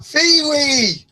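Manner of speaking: loud high call across the house. Real, not acted. Calling to Phoebe in another room, loud. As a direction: shout the name to reach her.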